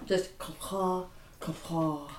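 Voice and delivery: soft synthetic computer voice; computer animation voice. Pretend. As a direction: do a quiet computer animation sound. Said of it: "Could somebody please do that?".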